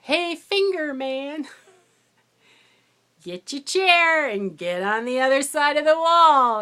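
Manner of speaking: loud, high call with drawn-out words; yelling across the room. Retold or imitated. Substitute teacher to a student across the room, yelling. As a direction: holler it across a noisy classroom. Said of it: "yells across the room".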